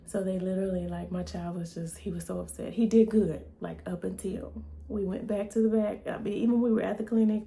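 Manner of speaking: usual speaking voice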